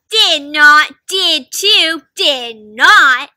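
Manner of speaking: loud back-and-forth squabble; loud bickering. Acted. Friends fighting over the torn letter, bickering loudly.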